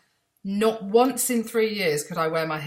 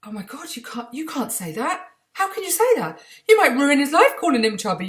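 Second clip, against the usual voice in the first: shocked parent; 7 semitones higher, 4 dB louder than usual. Retold or imitated. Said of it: "we'd be like".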